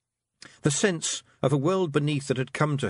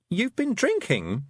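slow, higher disbelieving question; shocked young girl; Tiffany's voice; 9 semitones higher, slower than usual. A narrator's reading. Tiffany scolding the Feegles, disbelieving girl voice.

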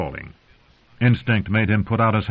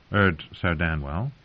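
usual speaking voice

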